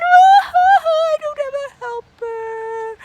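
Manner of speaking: high, whimpering mock-sad Adam; a hammed-up lonely whine; childlike sad Adam sighing for a helper. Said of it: "He was sad".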